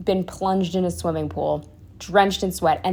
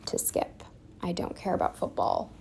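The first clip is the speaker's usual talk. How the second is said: unvoiced whisper, a confiding aside; 13 dB quieter than usual. Real, not acted. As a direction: whisper it like a secret. Aside about skipping the party.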